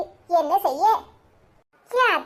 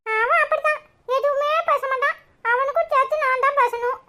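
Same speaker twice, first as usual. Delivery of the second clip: put-on much higher, chirpy small child; little boy voice; 9 semitones higher than usual. Cheeky boy greeting neighbour Lakshmi aunty, high child voice. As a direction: play a cheeky young kid.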